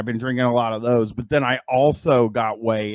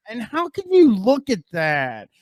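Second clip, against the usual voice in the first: disgusted; 8 semitones higher than usual. Real, not acted. Disgusted at sandwich.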